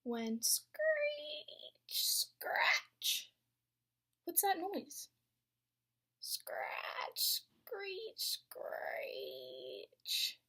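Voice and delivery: whispered spooky noises. Acted. Spooky night noises in story.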